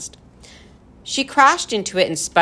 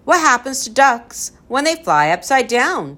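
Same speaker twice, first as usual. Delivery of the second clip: high, loud riddle; crying out; 7 semitones higher, 5 dB louder than usual. A narrator's reading. Tom offers a duck riddle, crying out. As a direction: fire the question out eagerly. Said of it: "Tom cried".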